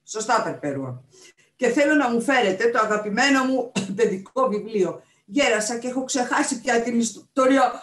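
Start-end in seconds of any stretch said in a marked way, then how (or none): 1.6-7.7 put-on old captain, higher and loud; forgetful old captain asking a favour; elderly sea captain.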